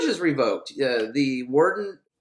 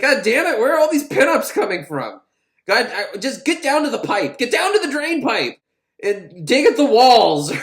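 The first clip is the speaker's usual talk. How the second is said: higher, louder mock angry guard; 8 semitones higher, 6 dB louder than usual. Guard Muncie sending inmates to the drain pipe, mock angry yelling. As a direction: yell orders like a fed-up guard. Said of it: "He's like".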